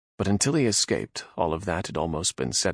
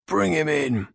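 orc guard; 10 semitones higher, 5 dB louder than usual. Acted. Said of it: "the orc said".

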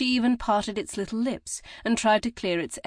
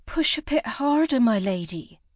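put-on higher, coaxing voice of an older midwife; urging midwife; 5 semitones higher, 4 dB louder than usual. A narrator's reading. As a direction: coax her to push a little more. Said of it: "Lucy the midwife urged".

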